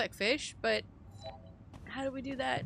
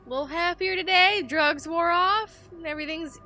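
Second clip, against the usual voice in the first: much higher, sing-song chatty voice; overly cheerful greeting; 8 semitones higher than usual. Pretend. Player greeting the depressed biologist, chirpy and high. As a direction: coax him like a nervous host.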